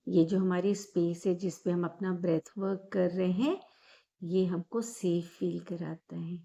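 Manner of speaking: calm guided breathing meditation voice. Acted. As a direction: ease the listener into calm, gently. Leads guided meditation after intro.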